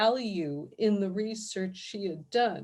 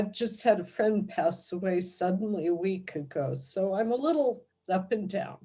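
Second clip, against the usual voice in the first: ordinary talk, nothing marked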